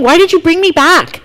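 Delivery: put-on a girl angry at being revived; indignant demand. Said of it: "this is her attitude".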